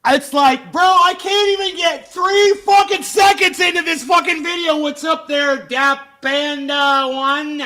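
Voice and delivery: high, loud frustrated shout; exasperated yell. Real, not acted. Streamer annoyed he can't watch his video, shouting. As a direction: blow up at the interruption.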